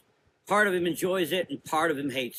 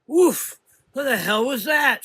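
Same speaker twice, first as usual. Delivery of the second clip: much higher, much louder shout over alarms; startled shout; 11 semitones higher, 9 dB louder than usual. A narrator's reading. Rose shouting over the fire alarms, startled. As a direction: yell over ringing bells. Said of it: "shouted Rose above the ringing alarms".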